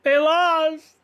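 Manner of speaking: hammed-up heartbroken fan; retold despair. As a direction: whine out the loss. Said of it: "I said".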